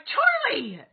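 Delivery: put-on loud, high-pitched shout of the child's name; scolding call. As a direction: yell it like a mom catching her kid in the act. Calling out her daughter caught misbehaving.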